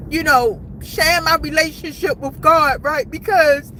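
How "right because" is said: ordinary talk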